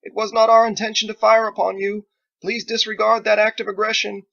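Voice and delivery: high, loud formal apology hurled across a field; shouting apologies. Acted. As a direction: yell polite words to be heard far off. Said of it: "shouting verbose apologies across the killing field".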